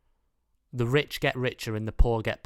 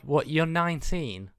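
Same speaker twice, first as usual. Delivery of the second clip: higher-pitched, slow mock condescension toward a teen; patronising older mate; 6 semitones higher, 3 dB louder than usual. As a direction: talk down to a kid, hammed-up. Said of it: "I'm like".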